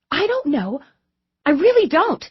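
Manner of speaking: high, rising flustered denial; anxious protest. A narrator's reading. Receptionist flustered on the phone with Lisette, anxious. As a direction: nervously insist into the headset.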